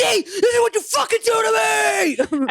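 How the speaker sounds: high-pitched furious scream; losing it. Retold or imitated. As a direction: hurl the blame in a re-enacted screaming fit. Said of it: "I fucking lost it on her ass".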